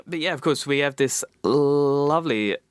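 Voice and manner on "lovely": LOVELY stretched out long; drawn-out lovely. Real, not acted. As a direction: linger on the start of LOVELY. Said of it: "That was a long lovely".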